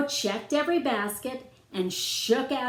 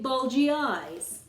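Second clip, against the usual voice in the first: plain reading voice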